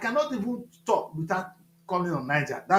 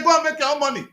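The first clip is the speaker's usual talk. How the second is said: raised, higher voice, telling them off; retold shout; 6 semitones higher, 4 dB louder, faster than usual. Governor shouting at officials asking for money. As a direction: bark the order at them. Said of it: "I shout".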